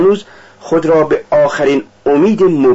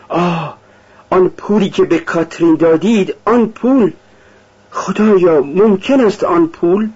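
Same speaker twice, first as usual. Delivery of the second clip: higher-pitched, alarmed exclamation without real shouting; sudden cry; 6 semitones higher than usual. A narrator's reading. Sonia suddenly asks about the money given to Katherine, crying out. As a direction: cry out as a dreadful thought strikes. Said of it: "ناگاه فریاد زد".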